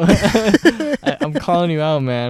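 loud playful call-out; mock threaten a friend. Real, not acted. Calling out a friend.

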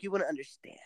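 breathy unvoiced whisper. Real, not acted. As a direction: drop to a whisper for a snide aside. Snarky aside to co-host.

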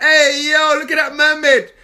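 high-pitched amazed holler; excited calling out. Acted. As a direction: call out a startling sighting in passing. Calls out while dragged through water.

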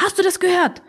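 high-pitched, urgent asking; insistent question. Acted. Oma presses Opa after the knock, high and insistent.

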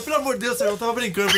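high-pitched, hammed-up pleading fan; mock begging. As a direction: play the scared heckler, begging for mercy. (imitando o torcedor implorando, voz aguda)

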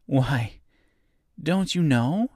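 high, mildly surprised question; a touch of astonishment; surprised little girl. A narrator's reading. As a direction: ask in light girlish surprise. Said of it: "she returned in surprise".

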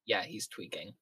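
hushed, low aside to a companion; quiet in-character murmur; whispered aside. Acted. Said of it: "I whisper to Oliver".

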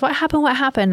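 put-on high, urgent little-girl voice; worried child. Little daughter running down the stairs, high and urgent. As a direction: ask it like a startled small child. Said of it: "She comes running".

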